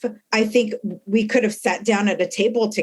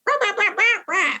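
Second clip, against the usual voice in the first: much higher mock blustering; blunt rant; 13 semitones higher than usual. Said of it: "you're like".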